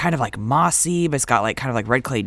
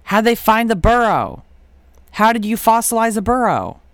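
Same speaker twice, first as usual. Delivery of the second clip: high, loud amazed questions; 7 semitones higher, 5 dB louder than usual. Real, not acted. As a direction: blurt out in disbelief.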